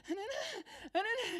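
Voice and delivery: high squealing no no, half laughing; mock panic of a girl dodging a needle; imitating panicked squeal. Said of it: "and she's like".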